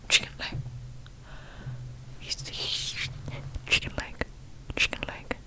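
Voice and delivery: very soft, voiceless whisper. Pretend. Comic repeating a phrase to himself, whispered. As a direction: whisper it softly, with long gaps between.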